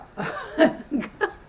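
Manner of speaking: high burst of laughter; sudden laugh. Real, not acted. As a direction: break into laughter. Laughing mid-explanation.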